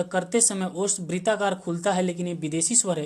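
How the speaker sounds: ordinary talk, nothing marked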